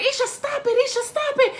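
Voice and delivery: a hint of a calming neighbor; lightly voiced neighbor. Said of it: "she's like".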